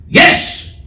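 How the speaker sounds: mock crowd yell; imitated crowd scream. Hundred fifty people answering the speaker, imitated scream. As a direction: scream it out like a responsive audience. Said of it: "Hundred fifty people screamed out".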